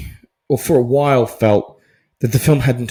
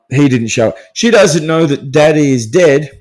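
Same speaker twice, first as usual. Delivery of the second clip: ordinary talk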